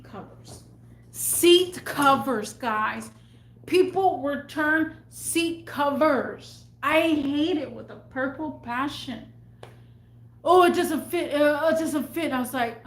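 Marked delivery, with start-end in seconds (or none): 1.1-2.5 SEAT COVERS drawn out slowly and loud; "Seat covers" hit hard, word by word; exasperated emphasis. Real, not acted. Incredulous emphasis on hated returns. 10.4-12.3 high, loud whiny mimicry; exaggerated mock complaint; mocking customer. Mocking customer's return excuse.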